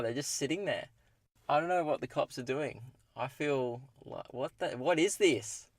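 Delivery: dropping to a hushed voice. Real, not acted. Cops parked right behind him.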